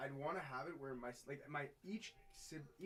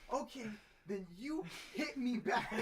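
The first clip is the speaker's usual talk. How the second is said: high, loud, slow, laughing through it; kid's reply, laughing; 11 semitones higher, 10 dB louder, slower than usual. Retold or imitated. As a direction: laugh while voicing the sister's offer. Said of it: "So she's like".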